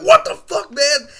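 high, loud outburst of disbelief; shocked yell. Retold or imitated. Reacting to the stabbing scene, shocked yell.

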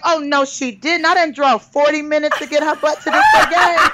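hammed-up offended mother; mock outrage. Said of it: "And my ego was like".

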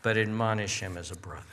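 low, soft, gentle admonition; pastoral, loving. Acted. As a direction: say it tenderly, like a pastor to a brother. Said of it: "Paul very pastorally, very lovingly says".

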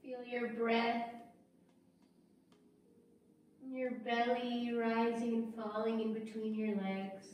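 guided breathing meditation. Real, not acted.

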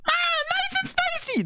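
very high squealed catchphrase; character voice-line imitation. Gamer guessing a character's line, very high imitation.

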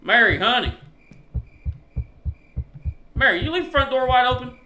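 high, loud accusing question; indignant calling out. Pretend. Husband asking Mary about the front door, indignant call. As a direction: holler the complaint at your wife.

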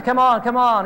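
preacher urging the caller to admit lying, with coaxing insistence; pressing. Retold or imitated. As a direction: push him to come clean.

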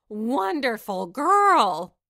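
loud, slow, delighted cry; the knight bursts out in joy; drawn-out exclamation of delight; excited cry. Acted. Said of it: "cried the knight".

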